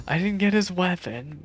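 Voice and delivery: ordinary talk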